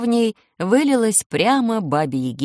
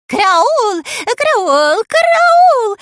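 high, loud panicked shrieks; wild shrieking; 8 dB louder, much slower than usual. A narrator's reading. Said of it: "визжала она диким голосом".

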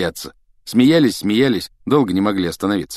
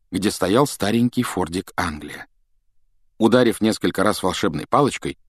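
plain reading voice

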